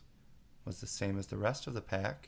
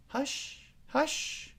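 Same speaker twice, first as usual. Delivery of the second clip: much higher, hushing rabbit; low hurried hush. A narrator's reading. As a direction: urgently shush her. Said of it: "in a low, hurried tone".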